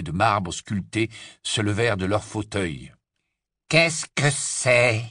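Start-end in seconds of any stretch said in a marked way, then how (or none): 3.7-5.0 slow, drawn-out haughty drawl. Acted. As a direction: drawl the question lazily. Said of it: "La voix traînante".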